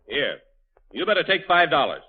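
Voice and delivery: normal talking voice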